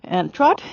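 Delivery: TROT rising at the end; upward transition command. Shown as a demonstration. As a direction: lift the voice on "trot". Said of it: "the voice goes up".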